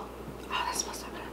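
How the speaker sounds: soft breathy whisper. Real, not acted. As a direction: whisper it like a secret. Savoring a smell, hushed.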